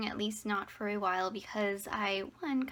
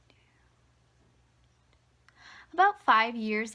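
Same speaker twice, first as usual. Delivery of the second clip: usual speaking voice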